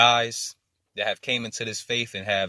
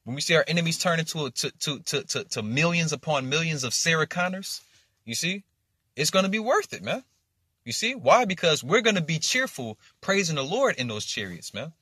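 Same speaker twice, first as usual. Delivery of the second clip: higher-pitched, eager preaching; fired-up preaching; 6 semitones higher than usual. Real, not acted. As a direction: keep the preaching excited, with Why punched up.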